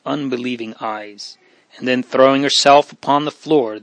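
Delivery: ordinary delivery, nothing marked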